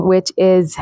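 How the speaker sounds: ordinary talk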